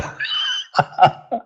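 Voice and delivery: short laugh at own joke. Real, not acted. Host amused by his own accent joke, laughing. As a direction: chuckle to yourself at your English-accent gag. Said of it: "je ris tout seul".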